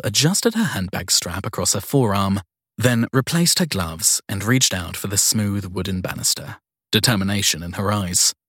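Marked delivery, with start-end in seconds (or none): none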